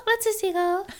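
high, giggly airhead echo; a ditzy blonde, amused; dumb blonde voice. Acted. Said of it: "That's my dumb blonde voice for both of us".

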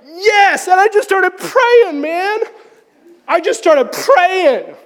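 high, elated exclamation; exultant relief. Retold or imitated. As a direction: celebrate being set free, pitch way up and words stretched. Relief after the lie is lifted.

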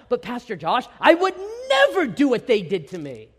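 shocked indignant objection; indignant protest. Retold or imitated. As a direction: protest as if wronged. Voicing indignant congregant.

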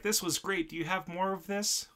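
high, pleading cartoon cat; eager cat voice. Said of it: "And he's like".

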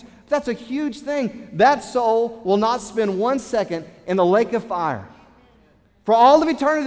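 high, loud, impassioned preaching; fiery pulpit preaching, pitched high and loud; preaching at full force. Acted. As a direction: preach it out to the whole church. Preaching with rising passion.

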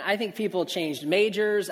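normal talking voice

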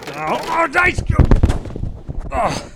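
sudden high-pitched shriek; startled yelp. Acted. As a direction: yelp in shock. Hit by ice in freezer.

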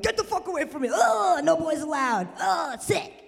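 hammed-up revulsion; disgusted shove-off. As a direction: ward off a creep. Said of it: "So I'm like".

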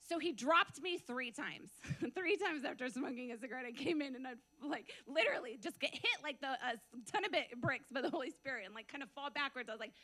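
normal talking voice

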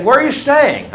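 high, loud and eager, as the starstruck shopkeeper; excited question. Retold or imitated. Shopkeeper eagerly asks the man he thinks is a star. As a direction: pounce on him with the question.

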